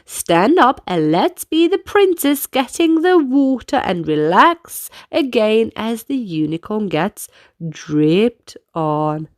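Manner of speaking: plain reading voice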